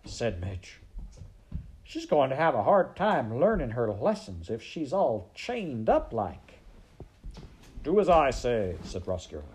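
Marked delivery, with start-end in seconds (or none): 1.8-6.6 put-on loud, high sing-song serving girl; Midge. A narrator's reading. Serving girl doubting the lesson plan, loud sing-song. 7.8-8.8 high, loud order; commanding. A narrator's reading. Rat ordering the serving girl, commanding.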